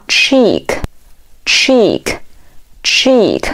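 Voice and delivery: "cheek" slowly, with pauses between; model pronunciation. Shown as a demonstration. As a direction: say "cheek" drawn out for learners to repeat. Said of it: "读作". (慢速领读cheek，每遍停顿)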